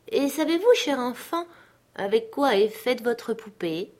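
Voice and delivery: put-on high sing-song question to a child; coaxing. A narrator's reading. Dignitary questioning the little girl about her doll, coaxing.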